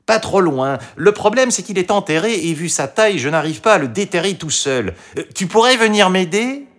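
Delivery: high-pitched persuasive fox voice; coaxing fox. A narrator's reading. The fox asks Toutou to help dig, coaxing. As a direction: coax the dog, pitched well up.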